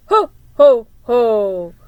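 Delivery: loud mock Santa laugh; jolly ho ho. Girl jingling a box, playing Santa, loud jolly ho-ho. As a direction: boom it out like a playful Santa.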